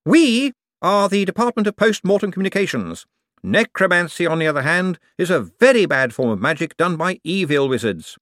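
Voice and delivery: high, eager, bright-voiced wizard; a jumpy official covering for himself. A narrator's reading. Hicks explaining his department.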